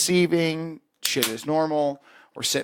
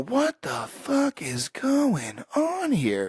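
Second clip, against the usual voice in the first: slow, incredulous whispered aside; stunned whisper; 10 semitones higher, 4 dB quieter, much slower than usual. Retold or imitated. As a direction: whisper it drawn out to a buddy, baffled. Stunned aside to buddy in church.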